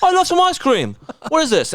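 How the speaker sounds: high-pitched mock-Scottish voice; squeaky-high caricature of a Scottish accent. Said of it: "the Scottish one. He was like".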